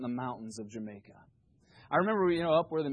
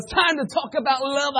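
loud, high preacher shout ending in a gasp; gravel-throated preacher; 11 semitones higher, 9 dB louder than usual. Retold or imitated. Mimicking the preacher who can't get breath, gravelly shouted impression. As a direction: holler it like a fireball preacher, then heave for breath.

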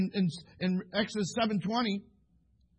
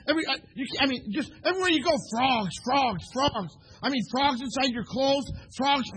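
high, loud, piled-up list; excited preaching; 6 semitones higher, 5 dB louder than usual. Real, not acted. Preacher making kids picture frogs everywhere, excited preaching. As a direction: heap it up with rising preacher's excitement.

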